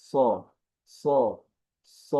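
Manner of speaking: "স" said with a thick, rounded "so" sound; isolated "স", heavy s with an o-coloured vowel. Shown as a demonstration. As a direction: demonstrate "স" as a full, emphatic Arabic Sad. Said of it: "তবে আওয়াজটি মোটা হয়".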